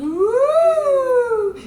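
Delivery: a very high, loud owl-like woo; high owl hoot. Shown as a demonstration. Singing teacher demonstrating the owl sound, a high loud hoot. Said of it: "our owl sound".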